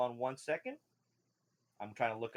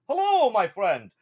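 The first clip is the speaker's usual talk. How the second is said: high, wobbly merchant voice; eager fish shopkeeper with a wobble; wobbly fish man; 5 semitones higher, 6 dB louder, slower than usual. Acted. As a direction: hawk your wares as a fish man. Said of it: "a wobble in his voice 'cause he's a fish man".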